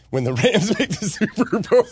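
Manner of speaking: laughing through the words; can't keep a straight face. Real, not acted. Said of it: "I can't even say that with a straight face".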